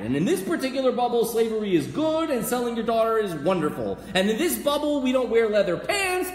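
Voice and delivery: high, loud, hammering tirade; heated rant. Acted. Preacher lecturing objector about living in a bubble, heated rant. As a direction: rail at them without let-up.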